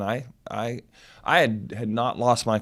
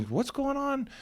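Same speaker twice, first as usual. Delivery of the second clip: high-pitched, softer retold question; questioning judge; 14 semitones higher, 5 dB quieter, slower than usual. Judge asks about the dispute, high imitated question.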